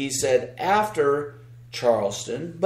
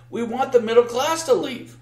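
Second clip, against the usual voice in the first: quoting him in a raised, higher voice; retold quote, pitched up; 8 semitones higher than usual. Host retelling a slip on Tucker Carlson, higher mocking quote. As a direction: lift the pitch to mock his words. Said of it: "he specifically said".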